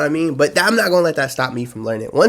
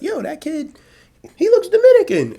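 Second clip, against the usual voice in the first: high-pitched mock voice, rising loud; squeaky gossip voice; imitating a bystander; 4 dB louder than usual. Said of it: "they be like".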